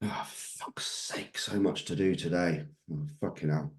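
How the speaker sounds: a grumpy waker cursing the morning, grumbling under breath. Pretend. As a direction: mutter your complaints to yourself. Acting a grumpy morning mindset.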